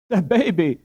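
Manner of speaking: high, loud startled echo; astonished echo. A narrator's reading. Wife echoing her husband in astonishment. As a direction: repeat it in shock. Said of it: "echoed Dame Brinker".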